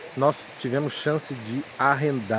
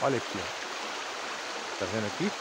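hushed; 6 semitones lower, 12 dB quieter, slower than usual. Real, not acted. Quiet aside showing grapes.

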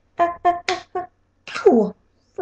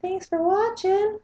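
much higher and slow sign-off; sing-song sign-off; 11 semitones higher, slower than usual. Real, not acted. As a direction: sing it out to the viewers. The first sign-off.

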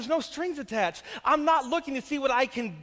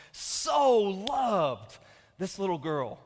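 tender; 4 semitones lower, much slower than usual. Real, not acted. Father recalling his love for his daughter, tender. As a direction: breathe it with tenderness.

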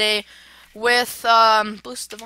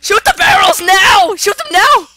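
urgent shouted order; 6 semitones higher, 9 dB louder than usual. Real, not acted. Player urging teammate to blow up the barrels, urgent shout.

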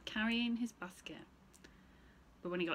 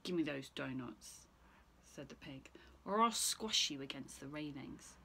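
low piggy voice; 5 semitones lower than usual. Acted. Pig demands donuts from Stephen, low and hushed. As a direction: grunt it low and sly.

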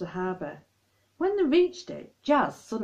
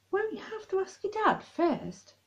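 curious; 13 semitones higher, 3 dB quieter than usual. Acted. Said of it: "asked Kyla curiously".

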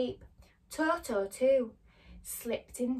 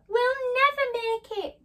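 high, louder, slow despairing cry; frightened cry; 14 semitones higher, 8 dB louder than usual. Acted. Dorothy cries out in panic at the chase. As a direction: cry out in fear. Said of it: "cried Dorothy".

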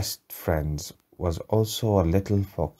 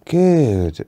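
plain reading voice

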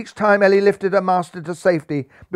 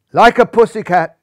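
loud, higher jeering shout; 4 semitones higher, 8 dB louder than usual. A narrator's reading. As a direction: shout it out mocking the beaten tiger. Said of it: "shouted the crowd".

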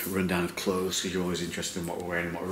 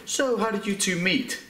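mock interviewer; 11 semitones higher than usual. Mock question from others.

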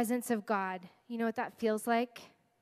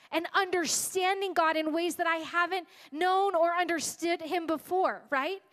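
raised, emphatic preacher's delivery; driving sermon voice, hitting key words; impassioned preaching; 5 semitones higher, 4 dB louder than usual. Real, not acted. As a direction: preach it with rising conviction, higher-pitched. Preaches the point of her story.

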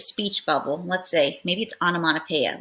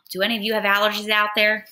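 ordinary delivery, nothing marked

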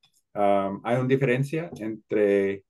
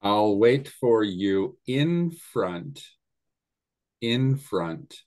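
"in" held, slow; stress on in; much slower than usual. Shown as a demonstration. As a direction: lean on "in front". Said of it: "we need the word in".

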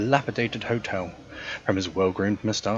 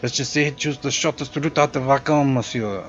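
louder, hammed-up French-European accent for a snooty concierge; hotel concierge greeting a guest, in a louder generic European accent; 5 semitones higher, 6 dB louder than usual. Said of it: "my generic European accent".

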